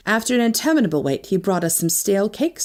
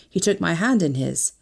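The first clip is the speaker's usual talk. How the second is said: ordinary delivery, nothing marked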